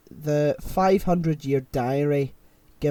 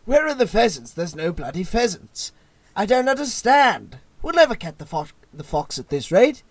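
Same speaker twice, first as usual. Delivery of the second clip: loud, raised mock-posh voice; Jimmy Carr mocking posh David; 5 semitones higher, 8 dB louder than usual. Retelling a panel show jibe, hammed-up posh mockery. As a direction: take the piss out of someone posh. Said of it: "Jimmy Carr is like".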